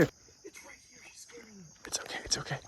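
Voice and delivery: hushed whisper near the caiman; stalking whisper. Real, not acted. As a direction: whisper so the caiman won't spook.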